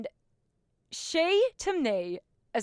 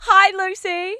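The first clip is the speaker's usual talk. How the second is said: loud, high-pitched hello; excited greeting; 10 semitones higher, 10 dB louder, slower than usual. Real, not acted. Host greets her guest after the introduction, excited greeting. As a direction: greet them at full volume, pitched right up.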